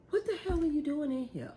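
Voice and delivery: high, quick startled what; a high, fast surprised snap at the kid; startled question. Retold or imitated. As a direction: blurt it out caught off guard. Mom startled, quoting herself.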